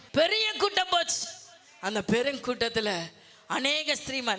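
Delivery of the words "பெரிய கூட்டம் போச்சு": preaching shout. Real, not acted. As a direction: proclaim it out to the congregation. Preacher stressing huge crowd.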